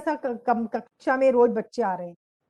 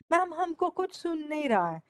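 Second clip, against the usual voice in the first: mock student complaint; 4 semitones higher, 5 dB quieter than usual. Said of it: "पीछे वाले जानबूझ के बोलते थे".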